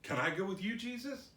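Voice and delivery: high, rising little plea; acted wheedling request; begging. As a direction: ask eagerly as the healed man, lifting at the end. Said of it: "begged Jesus".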